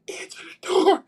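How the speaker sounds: high, tense order; retold panicked instruction; urgent hissed order. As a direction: push the roommate to the door. Hiding, tells roommate.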